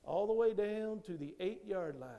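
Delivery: normal talking voice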